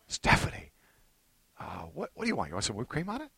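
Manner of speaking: very soft, eager-to-please offer; hushed acted coaxing; tender doting. Husband offering wife whipped cream, tender and soft.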